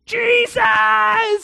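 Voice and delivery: very high, loud cry; desperate yell. Acted. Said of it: "He's just yelling".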